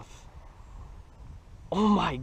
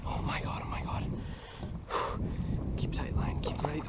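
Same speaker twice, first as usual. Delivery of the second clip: whispering; 9 dB quieter than usual. Real, not acted. Whispering while landing a fish.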